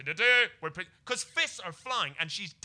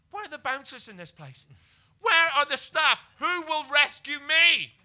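hammed-up panic; 12 semitones higher, 13 dB louder than usual. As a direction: wail for help like a damsel in distress. Panicked thought amid a bar fight.

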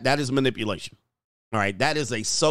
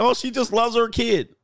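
mocking quote; 10 semitones higher than usual. Mocking what others say.

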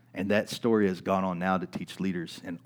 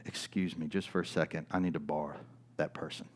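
soft, steady, icy politeness; quiet and controlled, polite on the surface; calm and quiet; 4 semitones lower, 8 dB quieter than usual. Retold or imitated. Pastor cutting into the register work, calm and quiet. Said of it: "with a straight face and a calm voice".

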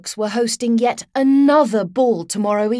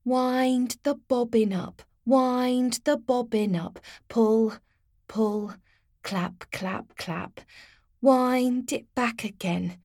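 slow, soft sing-song rhyme; careful recital; 5 dB quieter, slower than usual. A narrator's reading. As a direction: recite it slowly, calming yourself. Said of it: "carefully recited at the floor".

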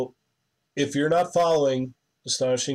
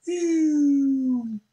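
high whiny ew; 12 semitones higher than usual. Retold or imitated. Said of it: "then it went".

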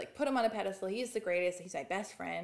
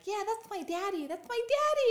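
put-on sobbing child; 14 semitones higher, 8 dB louder than usual. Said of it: "And I'm like".

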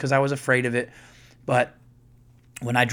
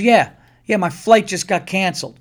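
loud, higher-pitched retold exasperation; irritated phone voice; 6 semitones higher, 6 dB louder than usual. Woman phones someone about her cancelled flight, mock exasperation. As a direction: act out her annoyed phone call, pitched up.

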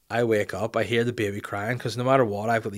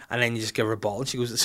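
ordinary delivery

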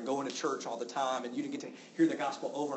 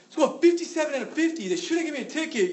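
high, loud whiny complaint; mock angry driver; 13 semitones higher, 8 dB louder than usual. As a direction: whine like a driver caught speeding. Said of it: "was really mad that they got a ticket".

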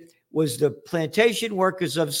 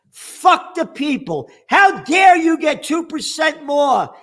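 much higher, louder, slow mock outrage; hammed-up scandalised rich man; mock outraged elite; 13 semitones higher, 7 dB louder, slower than usual. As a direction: shriek it like an indignant billionaire. Mocking the elite's attitude.

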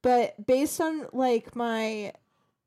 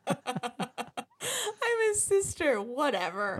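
laughing; 4 dB quieter, much slower than usual. Retold or imitated. Reenacting movie line, laughing.

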